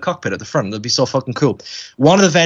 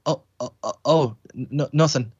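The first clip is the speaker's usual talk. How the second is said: soft, halting retreat; mock meek kid, trailing into a quiet stammer; imitating dejected child; 6 dB quieter than usual. Child gives up on the autograph, sadly and softly. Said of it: "saunter off really sadly".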